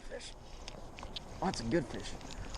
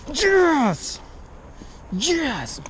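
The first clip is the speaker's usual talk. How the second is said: triumphant yell; 8 dB louder than usual. Real, not acted. Fish landed, triumphant yelling.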